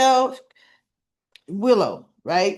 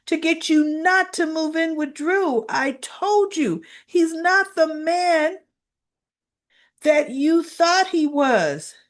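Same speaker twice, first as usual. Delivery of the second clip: lightly voiced, higher pleading; pleading Nina; 7 semitones higher than usual. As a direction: justify yourself, almost begging. Nina defends herself.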